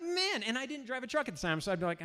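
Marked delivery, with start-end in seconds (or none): 0.0-0.4 higher, drawn-out reluctant Man; a high, sheepish Man before admitting; sheepish sigh. Retold or imitated. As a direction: admit it with a wince. Reluctant confession retold.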